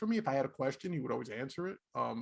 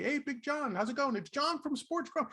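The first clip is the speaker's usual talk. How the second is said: higher, bright imitated greeting; imitating a cheery hello; 8 semitones higher, 3 dB quieter than usual. Ron greeting him in Manhattan, cheery imitated hello. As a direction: greet him like an old pal. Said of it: "every time I ran into him".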